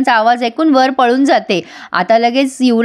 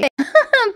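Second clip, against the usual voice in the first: short high-pitched giggle; light, high mock laugh; acted giggle; 11 semitones higher than usual. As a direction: imitate someone giggling at a quarrel. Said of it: "Pinky वरून हसत असते".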